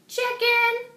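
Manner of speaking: high, loud taunt. A narrator's reading. Muffy taunts scared Arthur, high and loud. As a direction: jeer it like a playground insult.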